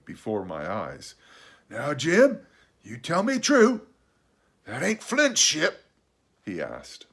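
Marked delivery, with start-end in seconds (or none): 1.7-5.8 high, louder castaway demand, suspicious; threatening. A narrator's reading. Castaway questioning the boy about the ship, threatening. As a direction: press for the truth with menace. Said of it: "raised the forefinger threateningly".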